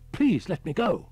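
high, loud desperate plea; frightened pleading. A narrator's reading. Frightened driver begging Holman to release him, high desperate plea. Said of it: "He said again".

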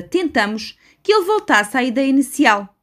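ordinary delivery, nothing marked

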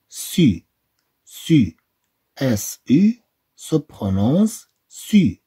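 slow syllable drill, "ce" said clearly; slow sound drill. Shown as a demonstration. Teacher drilling French syllables with pupils, slow. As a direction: model each syllable slowly.

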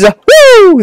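very high, loud woo; relieved whoop. Real, not acted. Gamer finally squeezing through, high loud whoop. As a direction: whoop in falsetto triumph.

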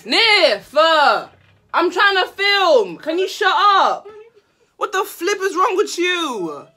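loud high yelling at sibling. Real, not acted. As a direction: shout them out of the shot. Shouting at interrupting sibling.

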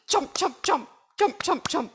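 high, quick chomp sounds. A narrator's reading. Quokka in croc costume, high chomping. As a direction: snap each chomp like jaws.

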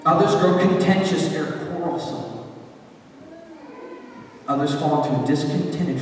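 usual speaking voice

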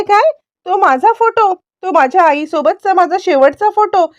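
high, stunned questions; can't believe the last photo is gone; shocked disbelief. Acted. Janaki learns her photo is lost.

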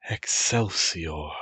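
ordinary delivery

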